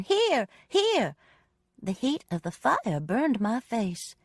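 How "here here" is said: screaming. A narrator's reading. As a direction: scream to guide them to her.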